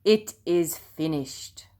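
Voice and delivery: slow, loud, solemn shout; Jesus shouts his last words from the cross. A narrator's reading. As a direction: proclaim it to the whole world. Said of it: "Jesus shouted in a loud voice".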